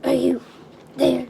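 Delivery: loud creaky rasp from a burning throat. Acted. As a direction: push it out hoarse and cracked. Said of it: "his creaky voice escaping".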